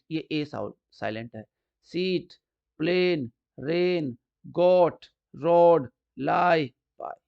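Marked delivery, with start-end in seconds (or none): none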